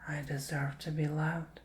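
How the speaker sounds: soft, slow, half-whispered affirmations; gentle affirmation chant for the viewer. Shown as a demonstration. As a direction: say it like a mantra you believe. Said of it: "Repeat this to yourself".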